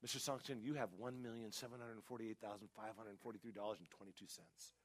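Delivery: very soft, confidential bank teller; imitated whisper. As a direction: read out a balance in a discreet hush. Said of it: "Why are you whispering?".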